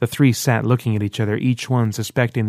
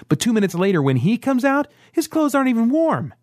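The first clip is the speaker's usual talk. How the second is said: incredulous accusation; 13 semitones higher than usual. Acted. Merrick accusing Ran about the boy, high and incredulous. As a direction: voice shooting much higher in disbelief.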